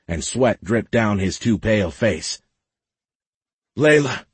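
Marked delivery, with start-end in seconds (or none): 3.8-4.3 high-pitched, gasped name; panicked gasp. A narrator's reading. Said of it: "he gasped".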